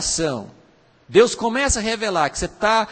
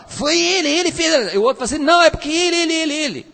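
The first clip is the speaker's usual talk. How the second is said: put-on higher, louder, whiny finger-pointing kid; blaming child; 8 semitones higher, 4 dB louder, much faster than usual. Brothers caught fighting blame each other, high whiny tattling.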